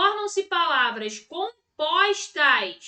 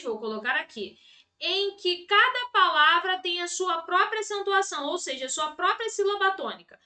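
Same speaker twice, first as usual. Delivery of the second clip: ordinary delivery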